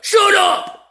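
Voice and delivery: high, very loud order to silence; explosive retold shout; shouted command. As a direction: imitate a cop shouting down a crowd. Cop silencing the yelling crowd.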